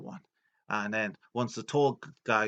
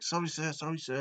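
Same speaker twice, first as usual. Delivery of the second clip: higher, hurried servile apology; apologetic underling; 7 semitones higher than usual. Retold or imitated. Domo apologising to his angry boss, servile and high.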